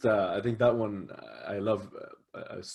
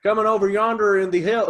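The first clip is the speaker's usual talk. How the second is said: put-on Southern accent; 11 semitones higher, 7 dB louder than usual. Friend tries the Southern accent after praising it, loud and high. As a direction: belt it like a backwoods yokel. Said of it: "with that accent specifically".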